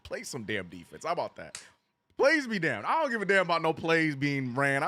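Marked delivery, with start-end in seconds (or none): 0.0-1.1 quiet, grumbled play some damn defense; low grumble. Real, not acted. First take, quiet. 2.2-4.2 plays be damned, flung out louder; fed-up outburst. Real, not acted. Repeats the phrase louder.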